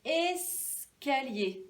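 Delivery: "Escalier" very slow, with a long es; first syllable isolated. Shown as a demonstration. Teacher giving the matching picture, first syllable isolated. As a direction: hold es, pause, then finish "Escalier". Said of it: "qui commençait par S".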